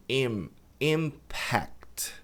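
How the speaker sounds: "impact" as IMM-pact, lips closed on M; careful "impact" with a sounded M; pronounced M. Shown as a demonstration. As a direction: hum the M in "impact", stressing IM. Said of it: "Im tem que pronunciar o M".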